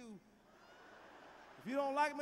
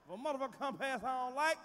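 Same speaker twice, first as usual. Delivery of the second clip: ordinary talk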